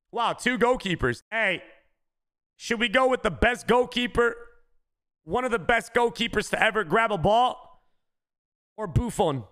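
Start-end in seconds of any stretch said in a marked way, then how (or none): none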